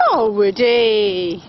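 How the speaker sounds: ordinary talk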